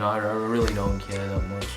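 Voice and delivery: ordinary talk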